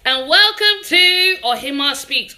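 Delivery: loud high show opener; excited. Real, not acted. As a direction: announce the show with energy. Live show opening greeting.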